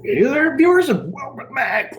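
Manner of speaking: very high, hyped-up greeting; enthusiastic. Shown as a demonstration. Dad demonstrating the channel intro, hugely enthusiastic. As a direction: belt the welcome in an excited high voice. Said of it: "say it with some enthusiasm".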